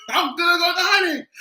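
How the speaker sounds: high, fast, sobbing laugh; cry-laughing. Real, not acted. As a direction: wail it through tears of laughter. Crying laughing.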